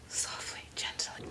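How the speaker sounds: hushed, near-whispered coaxing; soft self-coaching. Real, not acted. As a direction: coax yourself along very quietly. Coaxing a part into place.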